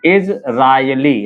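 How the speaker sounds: slow Israeli, each part held apart; measured dictation of Israeli for writing; syllable by syllable. Shown as a demonstration. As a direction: break Israeli into इज, रा, यली. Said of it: "Israeli कैसे लिखेंगे?".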